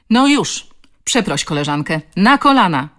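loud, barked orders; stern command. A narrator's reading. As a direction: order the bully down sharply. Woman orders bully to kneel.

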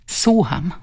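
restrained, hissed prompt; mildly hissed, curt word; tight-throated hiss. A narrator's reading. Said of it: "syknęła przez zaciśnięte gardło".

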